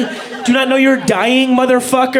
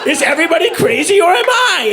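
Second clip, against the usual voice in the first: frantic author. Retold or imitated. Ostracized author frantic in the woods, hammed-up desperate call. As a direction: cry out lost in the woods. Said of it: "just frantic in the woods".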